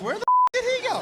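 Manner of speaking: high, loud disbelief; acted high-pitched astonishment; baffled outcry. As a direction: yelp it in total bafflement. Reacting to friend vanishing.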